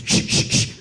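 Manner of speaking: whispered shushing; hushing. Retold or imitated. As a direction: hush everyone to listen. Brother hushing to listen.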